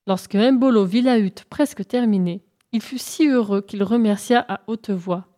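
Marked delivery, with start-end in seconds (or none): none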